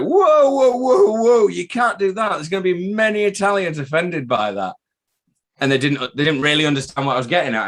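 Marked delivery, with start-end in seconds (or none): 0.0-4.8 mock alarm. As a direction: play at being scandalised, teasing. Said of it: "I was like".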